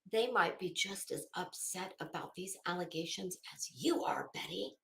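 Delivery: hushed unvoiced whisper. Confiding to Betty in a whisper. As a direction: breathe it like a secret to Betty.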